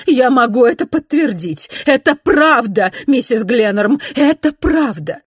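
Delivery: loud, high and frantic; urgent shouting. A narrator's reading. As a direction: cry out the orders in a rush of alarm. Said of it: "крикнула она".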